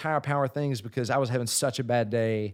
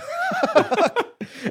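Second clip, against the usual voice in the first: a loud, high burst of real laughter; big high laugh; 8 dB louder than usual. Real, not acted. A singer cracking up at his own story, loud high laugh.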